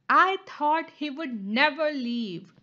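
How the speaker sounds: loud, eager pigeon voice; the sneaky pigeon, glad the driver left. Acted.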